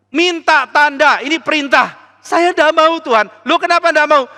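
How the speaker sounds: high, loud back-and-forth; God and the king bickering over the sign; heated argument. Acted. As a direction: quarrel insistently. God and Ahas arguing.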